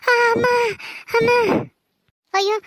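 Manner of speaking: stunned and scared; frightened cry. Acted. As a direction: gasp in shock, then ask in fear. Mistress has fainted.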